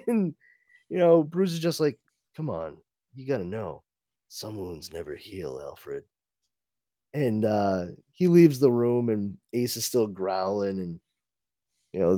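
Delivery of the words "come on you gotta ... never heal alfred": low, quiet, solemn Batman; low hushed superhero voice; brooding Batman. Acted. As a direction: deliver it like a grim hero, hushed.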